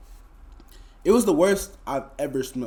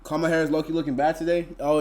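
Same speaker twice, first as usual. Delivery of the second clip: ordinary talk, nothing marked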